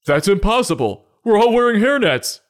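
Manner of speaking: high, climbing protest; indignant cook. Acted. Cook answering a hair-in-food complaint, high indignant denial.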